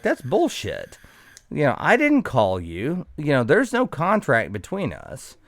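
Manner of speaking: refusing the bill from the fire department, heated; indignant retort. Retold or imitated. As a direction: push back, annoyed and loud.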